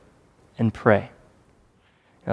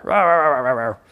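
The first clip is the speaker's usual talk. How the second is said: mock angry ranting noise. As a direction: imitate someone coming in on fire. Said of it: "just on fire".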